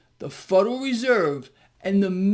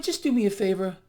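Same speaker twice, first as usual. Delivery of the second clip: usual speaking voice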